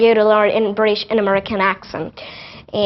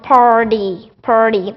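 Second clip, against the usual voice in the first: American PARTY with rolled-back R and D for T; slow American "parr-dee" for party; American accent; much slower than usual. Shown as a demonstration. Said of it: "roll your tongue and speak it R".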